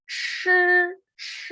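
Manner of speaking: "shi" held high and level; first tone. Shown as a demonstration. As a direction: sustain "shi" on one high pitch. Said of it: "Primer tono".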